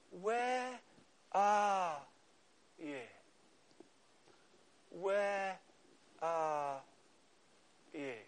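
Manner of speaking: soft, slow, searching "Where are you"; tender call. Pretend. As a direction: call gently like a loving father. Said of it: "He says".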